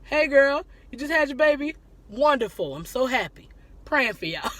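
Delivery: cheerful greeting demo. Shown as a demonstration. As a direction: greet the new mom with joy. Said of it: "Somebody just had a baby".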